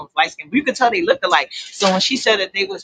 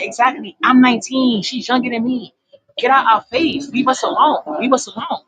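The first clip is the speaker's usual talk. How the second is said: insistent mock telling-off in a raised pitch; retold telling-off; 4 semitones higher than usual. Nineteen-year-old telling a man to back off, imitated telling-off. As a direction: imitate a girl's comeback, pitched higher. Girl tells man off, acted quarrel.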